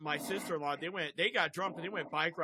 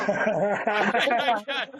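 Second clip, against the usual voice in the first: laughing; 3 semitones higher, 10 dB louder than usual. Real, not acted. Laughing while recalling being high.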